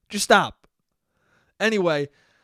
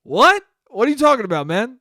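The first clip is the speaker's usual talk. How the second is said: a sudden high, loud disbelieving What; incredulous; 6 semitones higher, 7 dB louder than usual. Real, not acted. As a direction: shoot back in loud disbelief at the absurd excuse. Mock outrage at the excuse.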